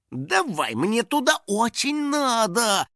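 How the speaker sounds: high, drawn-out wheedling; coaxing. A narrator's reading. House spirit talking the stones round, coaxing. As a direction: wheedle slowly in a high little voice. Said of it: "уговаривал он камни".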